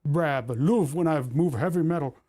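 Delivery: lightly voiced northeast England accent, like grandpa; hammed-up grandfather's accent; northeast English accent. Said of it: "was my grandfather's accent".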